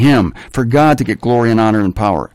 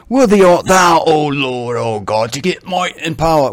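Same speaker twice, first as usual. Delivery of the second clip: put-on English accent. Preacher imagines the elders as English actors, English accent. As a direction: intone it like a grand English actor. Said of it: "they might have had an English accent".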